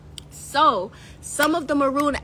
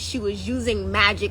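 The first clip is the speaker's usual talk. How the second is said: ordinary talk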